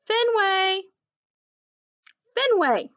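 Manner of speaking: high, loud scolding of the dog's name; scolding call. A narrator's reading. Owner scolding the jumping puppy, sharp call. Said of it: "Fetch Man scolds".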